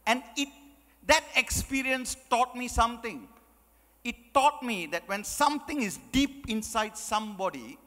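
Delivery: ordinary talk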